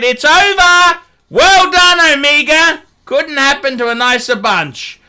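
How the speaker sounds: high, loud mock congratulations; jeering well done; sarcastic cheer. Real, not acted. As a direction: shout it like a gleeful taunt. Sarcastic farewell to Omega.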